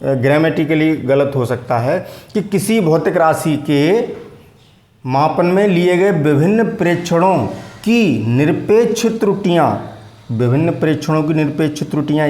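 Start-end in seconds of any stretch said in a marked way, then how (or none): 2.3-9.9 slow, deliberate dictation pace. Shown as a demonstration. Teacher repeating the definition for students to copy, slowly. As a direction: give it word by word for their notebooks. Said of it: "फिर दोबारा बोलता हूँ".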